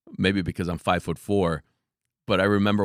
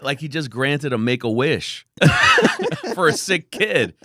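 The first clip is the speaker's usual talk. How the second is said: excited; 8 semitones higher, 6 dB louder than usual. Retold or imitated. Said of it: "He is so excited".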